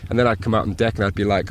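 usual speaking voice

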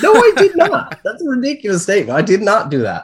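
high, laughing protest; laughing denial. Real, not acted. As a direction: squeal it out through laughter. Laughing denial of posh accusation.

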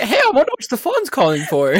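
high, laughing mock-innocent question; high giggly mock wonder. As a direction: laugh through the quote. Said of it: "I was like".

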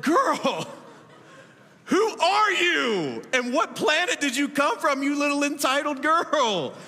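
half-playful mom telling off her daughter, stretched out; mock scolding mom.

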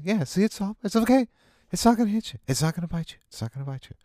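high, gentle coaxing voice; soothing a child. Acted. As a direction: coax a nervous kid closer. Coaxing continues, voice pitched up.

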